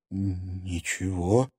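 slow, soft, stammering reply. Acted. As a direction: stammer out in fear. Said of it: "заикаясь".

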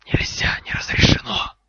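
loud, voiceless angry rasp of air; furious snake hiss. Acted. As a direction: hiss it hard, full of fury. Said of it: "яростно зашипела змея".

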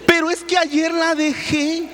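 high, slow plea; whining excuse. Retold or imitated. Husband in the story making a lame excuse, high and drawn out. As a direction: squeak out the excuse.

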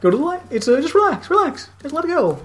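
high, airy mock psychic voice; mock medium. Friend mocking a psychic guiding a ghost, high airy. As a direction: coax the ghost toward the light, hammed up.

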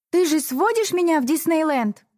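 put-on high, pleading girl's question; hopeful young girl. Sister asks hopefully.

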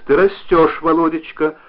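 lightly voiced cheerful wife; cheered up. A narrator's reading. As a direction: brighten up as the wife, pleased with him. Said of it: "услышал он её повеселевший голос".

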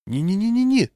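protesting. A narrator's reading. Walker stops patrolman removing his armband, protesting. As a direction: shoot the pitch up in a string of protest. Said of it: "запротестовал я".

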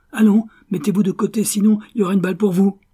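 put-on loud, high, fast drunken threat; shouted threat. Rosenthal threatening Purvis to step aside, loud shout. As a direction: bark the order, gun in hand.

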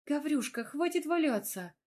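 high, exclaiming protest; indignant. A narrator's reading. Wife urging husband off the floor, indignant. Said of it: "возмутилась я".

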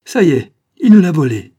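loud, high cry of alarm; outraged cry. A narrator's reading. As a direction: explode at discovering the theft. Realises the child was stolen.

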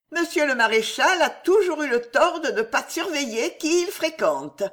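high-pitched, sweetly poisonous voice; honeyed venom. A narrator's reading. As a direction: pour honey over the gall, pitched high. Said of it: "d'une voix miel et fiel". (voix aiguë, miel et fiel)

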